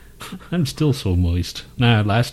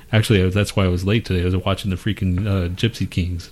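ordinary delivery

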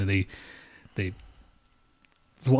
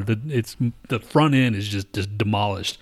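ordinary talk, nothing marked

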